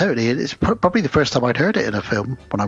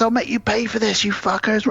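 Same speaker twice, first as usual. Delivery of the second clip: higher, hammed-up bully threat; mock bully threat; 9 semitones higher than usual. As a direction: squeak out the threat like a cartoon bully. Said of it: "He says".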